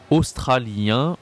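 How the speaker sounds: "Australien" stretched out slowly, rising at the end; slow model word. Shown as a demonstration. Teacher modelling a word slowly for learners. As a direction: draw out each syllable of "Australien". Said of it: "slow model word".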